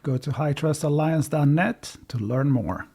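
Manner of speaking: ordinary delivery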